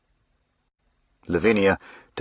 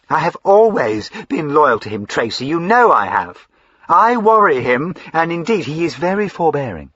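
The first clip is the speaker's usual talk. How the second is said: higher, excitable fine lady; eager young lady Lavinia; 9 semitones higher, 7 dB louder than usual. Acted. Lavinia gushing to her brother, high and eager. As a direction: gush up high like a spoilt sister.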